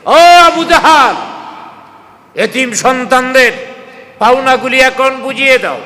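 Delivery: loud, high summons at the door; high-pitched, forceful demand; calling out. Retold or imitated. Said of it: "দরওয়াজার সামনে দাঁড়ায় ডাক দিছে".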